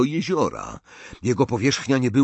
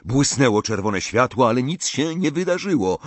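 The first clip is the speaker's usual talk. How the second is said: plain reading voice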